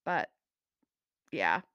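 normal talking voice

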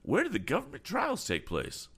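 put-on high, fussy old gentleman voice; flustered, eager old man; eccentric old gentleman. A narrator's reading. Mister Damon voiced.

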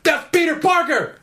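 high, loud fan shout of the name; excited shout. Retold or imitated. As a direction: yell the name like a thrilled fan.

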